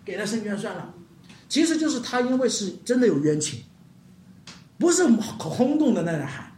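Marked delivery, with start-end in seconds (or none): 0.1-0.9 soft, resigned mock grumble; lightly acted exasperated judge; imitated weary annoyance. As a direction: give in, fed up with the nagging. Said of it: "好烦的这个妇人".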